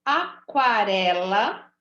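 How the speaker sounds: Aquarela drawn out with a clear kw; slow teaching read. Shown as a demonstration. Teacher reading the word list to pupils, slowly. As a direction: stretch Aquarela as a-kwa-re-la. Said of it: "Tá vendo como eu ouço o som do U?".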